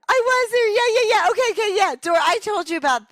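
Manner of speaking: very high, loud excited yeahs; excited squeal. Real, not acted. Friend squeals that she was there, very high excited.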